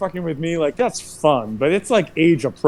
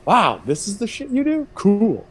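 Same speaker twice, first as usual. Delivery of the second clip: mock amazed; 9 semitones higher than usual. Reacting to translator neighbor.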